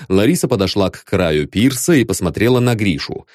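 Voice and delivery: ordinary delivery, nothing marked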